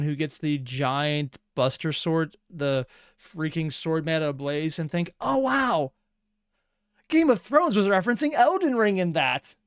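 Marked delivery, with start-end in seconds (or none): 5.2-9.6 high, naively excited exclamation; mock amazed fan. Imagined fan spotting a supposed reference, mock amazement. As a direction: gush like a fan making a big discovery. Acts out a future mistaken fan.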